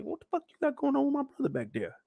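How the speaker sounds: high, fast, tight challenge; confronting sister. Retold or imitated. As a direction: grill the boss about her brother. Nikki confronts the boss.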